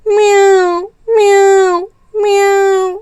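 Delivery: high, whiny cat meows; hungry cat mewing for food; cat meowing. Acted. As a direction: meow like a hungry cat crying. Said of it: "बिल्ली की आवाज़ सुनाई दे रही है".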